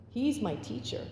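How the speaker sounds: ordinary delivery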